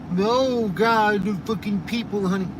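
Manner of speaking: high, loud whiny mock protester; mocking anti-hunter. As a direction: sneer at the hunting critics in a shrill mock whine. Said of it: "anybody that".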